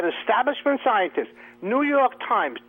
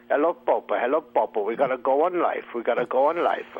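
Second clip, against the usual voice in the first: spy into his lapel; 6 semitones lower than usual. Retold or imitated. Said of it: "a guy talking into his lapel".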